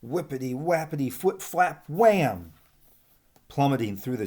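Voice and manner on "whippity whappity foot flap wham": rising, louder toward a big wham; building sound effect. A narrator's reading. Narrator building to the crash of the fall. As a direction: tumble the words out, landing hard on wham.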